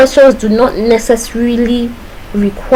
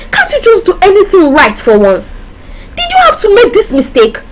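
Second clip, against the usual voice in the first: scolding parent; 9 semitones higher than usual. Acted. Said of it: "Maybe mommy at home or a sibling".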